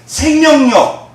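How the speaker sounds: slow, high, loud 생명력; emphatic key word. Real, not acted. Preacher stressing 생명력 to the congregation, slow and loud. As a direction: stretch 생명력 out slowly and loud. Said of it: "drawn-out key word".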